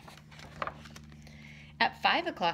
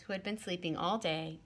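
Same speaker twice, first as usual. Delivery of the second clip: usual speaking voice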